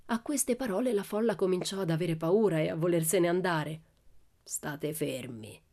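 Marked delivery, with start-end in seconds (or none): 4.4-5.6 very slow, low, hushed threat; menacing growl. A narrator's reading. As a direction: growl it low and drawn out, almost voiceless. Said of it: "ruggì Laban". (ringhio basso, lento e minaccioso)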